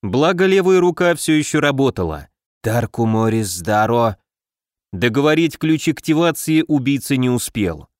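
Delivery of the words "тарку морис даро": low, hissed spell words. A narrator's reading. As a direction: hiss it out, pinned and hurting. Assassin hisses spell, wounded. (низкое шипение заклинания)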